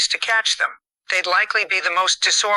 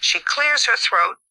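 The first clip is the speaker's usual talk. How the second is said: ordinary delivery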